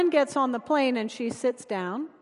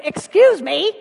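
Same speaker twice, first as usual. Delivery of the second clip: higher, louder pointed excuse me; a clipped call to get past, said louder; raised, pointed interruption; sharp excuse me; 5 semitones higher, 9 dB louder than usual. Retold or imitated. Friend politely asks to pass.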